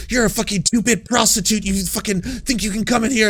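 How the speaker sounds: high, mock furious mother; imitating an enraged mom, high and loud; mock angry mom. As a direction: ham up a furious mother screaming. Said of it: "she's like".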